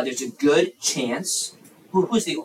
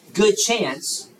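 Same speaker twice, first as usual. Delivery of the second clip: normal talking voice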